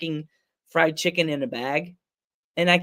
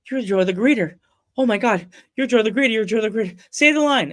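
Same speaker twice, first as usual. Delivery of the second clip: excited higher, louder gushing; starstruck fan; 6 semitones higher, 5 dB louder than usual. Retold or imitated. Fan recognizing Joe the Greeter, excited gushing. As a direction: gush like a fan spotting an idol.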